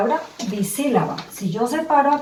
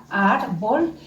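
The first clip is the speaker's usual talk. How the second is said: "árbol" split briefly, ár, bol; separated syllables; 3 dB quieter than usual. Shown as a demonstration. Teacher separates the word for pupils, separated syllables. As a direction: divide "árbol" into two distinct beats. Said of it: "lo puedo separar".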